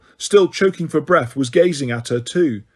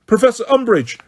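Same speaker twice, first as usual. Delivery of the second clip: much higher, louder cry; 8 semitones higher, 4 dB louder than usual. A narrator's reading. Hermione to Umbridge, crying out, higher and louder. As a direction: call out desperately. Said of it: "cried Hermione".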